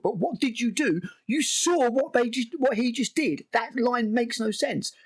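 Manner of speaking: high-pitched incredulous protest; exasperated argument. Real, not acted. Reviewer picking apart a badly written line, exasperated. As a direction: insist on it, high and baffled.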